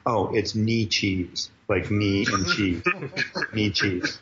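"knee cheese" said slowly, knee then cheese; mock pronunciation. Prankster slowly feeding Cyclops the fake name "knee cheese". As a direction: spell out "knee cheese" slowly, like two words. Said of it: "I go".

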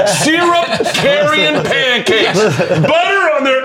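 high, slow, laughing through the words; a giggling, drawn-out riff up high. Real, not acted. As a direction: crack up while drawing it out.

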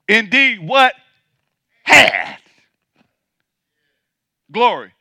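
each word shouted alone, long gaps before "had" and "glory"; drawn-out emphasis. Acted. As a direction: land hard on "had" as past tense. Repeats verse with long pauses.

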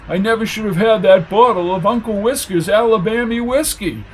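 high, lamenting character voice; regretful drunkard. Acted. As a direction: bemoan it in self-pity.

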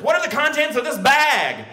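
high, forceful mock shout; hammed-up interrogating yell; demanding officer. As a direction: demand it like an irate patrolman. Patrolman's interrogation, acted.

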